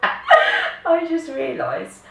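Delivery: giggling through the words, slowly; laughing. Real, not acted. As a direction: laugh while you talk. Laughing at her own squeal.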